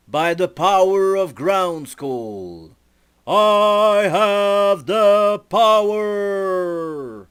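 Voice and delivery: very loud, slow, drawn-out proclamation; battle cry. Acted. E-Man raises his sword and shouts his power call. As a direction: roar it out like a superhero transformation.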